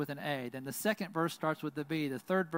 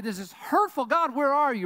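higher, louder cry to God; anguished lament; 9 semitones higher, 7 dB louder than usual. Acted. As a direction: call out to God in pain. Voicing a lament of grief.

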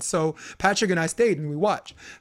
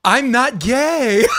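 deadpan joke; 5 semitones higher, 7 dB louder, much slower than usual. Retold or imitated. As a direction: drag it out slowly for the laugh. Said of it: "in the best way he knows how to make everybody laugh".

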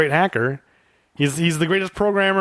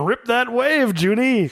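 higher, lightly voiced veteran actor's catchphrase; Ricardo Montalban voice; 7 semitones higher, slower than usual. Podcaster recalling the actor's final screen line, lightly voiced. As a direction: quote the old star fondly. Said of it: "he's on there going".

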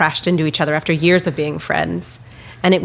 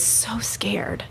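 slow unvoiced whisper of fear; 4 semitones higher, 10 dB quieter than usual. Real, not acted. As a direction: breathe it out, barely voiced.

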